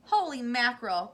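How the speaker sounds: loud startled cry; cried out. A narrator's reading. Mister T V fearing a collision, loud startled cry. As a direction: exclaim in shock. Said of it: "cried Mister T V".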